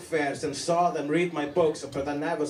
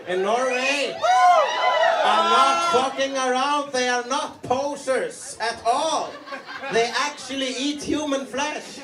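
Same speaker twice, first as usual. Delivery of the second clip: higher, louder hyped-up awe; mock awed rant; 7 semitones higher, 4 dB louder than usual. Comic telling the crowd about Norway's metalheads, mock awed. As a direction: rave about the metalheads like a fanboy.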